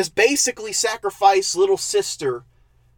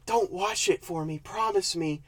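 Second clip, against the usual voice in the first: put-on quiet, pleading girl's voice, much softer; hushed plea; 11 dB quieter than usual. Girl begging her date not to watch, hushed. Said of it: "she goes".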